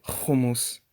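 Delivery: "hummus" as KHOO-moos, rasped kh; modern Hebrew pronunciation. Shown as a demonstration. Teacher quoting those without the sound, rasped kh. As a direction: rasp the first sound of "hummus". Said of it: "they just say".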